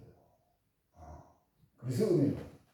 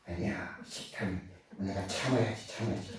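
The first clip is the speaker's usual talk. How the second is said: inner mutter; 8 semitones lower, 9 dB quieter than usual. Retold or imitated. Inner self-restraint thought.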